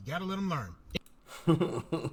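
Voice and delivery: slow and soft, in a put-on voice; slow, soft mock voice. Shown as a demonstration. Mocking a party boss, drawn-out soft put-on voice. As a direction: drag it out softly in a put-on voice.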